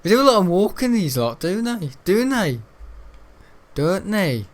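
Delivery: high, lilting teasing voice; high bouncy mocking lilt; sing-song mock.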